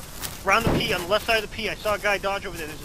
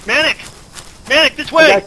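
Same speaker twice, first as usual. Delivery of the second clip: loud shout across the street; calling out; 6 semitones higher, 11 dB louder than usual. Pretend. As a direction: yell his name to get attention.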